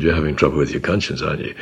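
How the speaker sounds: soft and low, a little quick; the Spirit's quiet nudge to a troubled Saul, softly; still small voice. Acted. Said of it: "The still small voice said".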